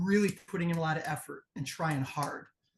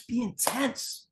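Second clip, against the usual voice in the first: demonstrated intensity; 7 semitones higher, much slower than usual. Shown as a demonstration.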